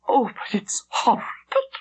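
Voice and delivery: horrified, crying whisper; tearful whisper. A narrator's reading. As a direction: breathe it out in tearful horror. Horrified girl after seeing execution.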